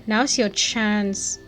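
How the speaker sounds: plain reading voice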